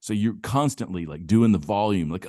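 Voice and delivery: ordinary talk, nothing marked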